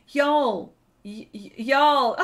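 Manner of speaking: loud, drawn-out "y'all"; showing off y'all. Shown as a demonstration. Streamer proudly repeating the word she managed, showing off "y'all". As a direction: stutter into a big, slow "y'all". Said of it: "Oh, look, I said it".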